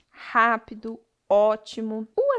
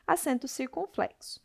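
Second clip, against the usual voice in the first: usual speaking voice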